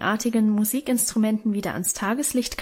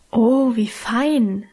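admiring exclamation; much slower than usual. A narrator's reading. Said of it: "rief sie und betrachtete bewundernd".

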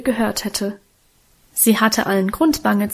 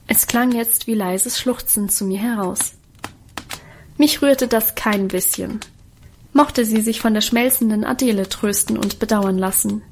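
usual speaking voice

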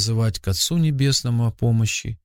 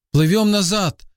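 higher-pitched, understated narrated shout; urgent cry; 8 semitones higher than usual. A narrator's reading. Father orders the boat turned back, mildly shouted. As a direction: call out urgently without full volume. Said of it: "крикнул отец".

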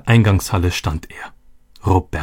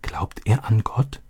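softly, almost a whisper; quiet; 6 dB quieter, slower than usual. A narrator's reading. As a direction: ask him under her breath. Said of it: "Leise fragte sie Vater".

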